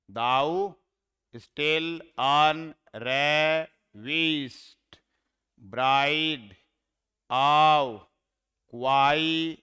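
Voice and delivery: very slow, each syllable held on its own; measured scansion with long gaps. Shown as a demonstration. Teacher recounting a verse line for students, syllable by syllable. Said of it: "हाँ, यहाँ पे छोड़ दिया".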